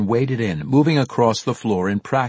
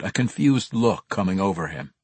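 usual speaking voice

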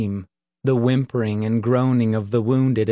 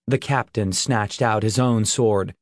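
ordinary delivery

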